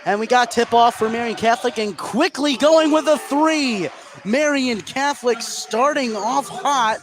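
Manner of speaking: high, rising call of the opening three; excited play-by-play. Real, not acted. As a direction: sell the first basket with energy. Tip-off call, first basket.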